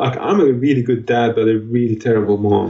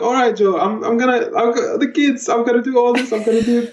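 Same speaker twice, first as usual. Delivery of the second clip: high, harried reassurance; squeaky put-upon dad promising to cope; mock-flustered self; 11 semitones higher than usual. Dad promising his co-host he'll manage the kids, high and flustered. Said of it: "I'm like".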